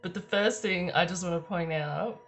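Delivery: ordinary talk, nothing marked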